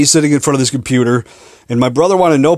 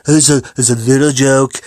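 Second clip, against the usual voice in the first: put-on voice of a boastful public speaker; Donald impression. Shown as a demonstration. Podcaster playing up an accidental voice, Donald impression. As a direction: say it in a mock Donald voice. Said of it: "sounds like Donald voice".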